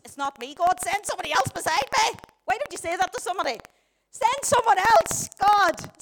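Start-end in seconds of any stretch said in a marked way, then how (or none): 0.0-2.2 lightly acted protest, rising in volume; reluctant pleading. Acts reluctant believer pleading with God. 4.1-6.0 loud, high, drawn-out protest; exasperated plea. Acted. Acts protest to God.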